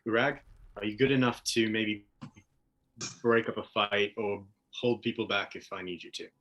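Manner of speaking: understated, low captain's request; low-key aside. Acted. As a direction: quietly check with a trusted comrade. Aside to comrade after lowering horn.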